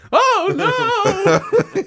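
high laughing yelp of alarm; mock yelp. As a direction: squeal it out, imitating panic. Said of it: "We gotta get that sound".

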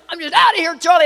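high, worked-up mock crying; retold tearful exit. Wife leaving Charlie at the doctor's, mock tearful. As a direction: announce the exit in hammed-up upset. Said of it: "I'm crying".

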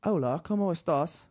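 a Brit trying out Spanish, slowly; British accent in Spanish. Shown as a demonstration. Dared by a friend, he speaks Spanish in a British accent. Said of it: "try doing a British accent while speaking Spanish".

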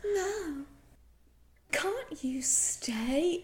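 slow, whispered creepy plea. Retold or imitated. Host imitating the creepy teacher begging the girl to stay. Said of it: "The way he says it is so creepy".

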